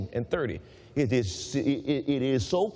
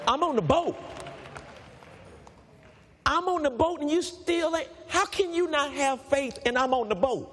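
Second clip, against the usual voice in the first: voicing Jesus; 6 semitones higher than usual. Preacher voicing Jesus, pitch raised.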